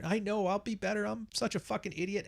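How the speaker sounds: high, soft grovelling apology; imitating a man caving in to please her; mock groveling. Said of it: "to keep the peace and agree with her".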